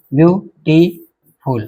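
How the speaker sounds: very slow, beautiful split into separate syllables; teacher slowly breaking up beautiful. Shown as a demonstration. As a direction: say beautiful piece by piece, pausing between parts.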